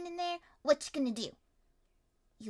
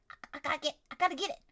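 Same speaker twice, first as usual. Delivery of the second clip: ordinary delivery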